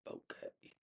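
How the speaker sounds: tiny whispered okay, imitating someone shut down; barely audible, backing off; meek whispered reply. Roommate answering after being told to get out, meek whisper.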